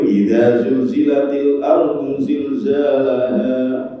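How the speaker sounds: slow chanted Arabic verse; Quran recitation. Acted. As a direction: recite it slowly like tilawah. (bacaan tartil yang pelan)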